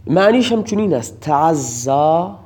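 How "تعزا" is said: تعزا stretched very slowly with a rising end; slow rising citation. Shown as a demonstration. Teacher introducing the word to students, slow and rising. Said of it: "به معنی صبر و شکیبایی است".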